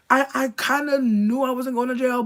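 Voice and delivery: ordinary talk, nothing marked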